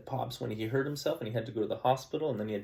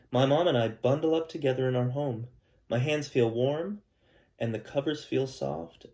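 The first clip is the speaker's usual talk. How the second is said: louder, gentle read-aloud storytelling voice; story narration; 2 semitones higher, 7 dB louder than usual. Acted. Teacher reading a picture book to her class, storytelling voice.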